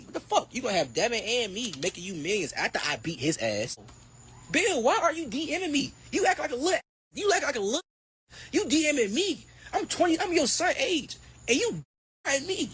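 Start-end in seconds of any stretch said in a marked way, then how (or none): 4.5-12.7 higher, heated call-out at Bill; worked-up rant. Real, not acted. Boxer calling out promoter Bill, heated and high. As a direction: fire it straight at Bill, pitched up.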